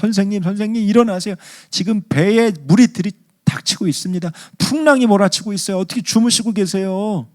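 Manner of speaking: high-pitched urgent plea; panicked disciples. Acted. Disciples waking Jesus in the storm, panicked plea. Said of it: "제자들이 예수님을 막 깨우면서".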